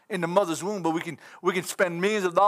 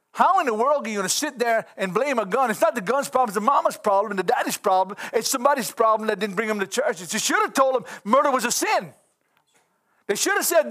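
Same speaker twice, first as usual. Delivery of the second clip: rising preaching; 4 semitones higher than usual. Acted. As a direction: build the sermon up, stirred. Preaching against blaming guns.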